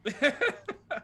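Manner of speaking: bright burst of laughter; high laugh. Real, not acted. Laugh after joke.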